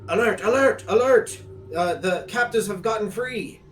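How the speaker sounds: high, loud cry of warning; shouted alarm. Acted. Cultist warning the others that captives are loose, shouted alarm. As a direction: sound the alarm at full voice. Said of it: "this one just says".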